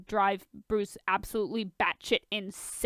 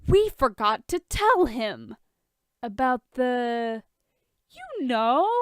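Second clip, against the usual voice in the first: put-on drunk; 3 semitones higher, 4 dB louder, slower than usual. Drunk Tony and Ollie.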